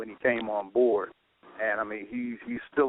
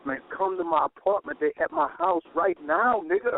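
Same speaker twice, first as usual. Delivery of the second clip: eager friend; 8 semitones higher than usual. Retold or imitated. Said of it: "He had me skip school".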